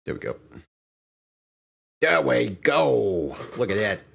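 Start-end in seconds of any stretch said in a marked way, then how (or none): 2.0-3.4 slow, relieved payoff line; slow drawn-out satisfaction. Real, not acted. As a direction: stretch out "There we go" slowly and louder. Says it twice, second drawn out.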